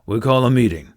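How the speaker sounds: loud chief's proclamation; announcing. A narrator's reading. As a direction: call it out to the whole party. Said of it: "Stoic announced".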